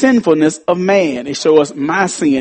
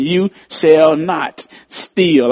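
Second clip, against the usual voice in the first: ordinary talk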